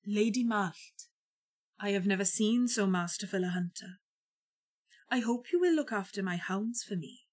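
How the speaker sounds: lightly voiced, measured lord; quiet, courtly address; courteous otherworld lord. A narrator's reading. Lord of the otherworld to the lady, lightly voiced courtesy.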